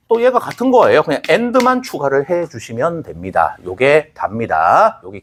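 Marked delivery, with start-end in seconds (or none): none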